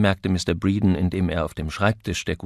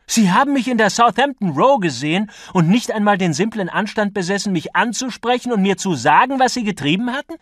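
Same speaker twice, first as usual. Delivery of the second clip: very high, loud indignation; furious outburst; 12 semitones higher, 9 dB louder than usual. A narrator's reading. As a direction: rail at your opponent in outrage. Acted quarrel, outraged accusation.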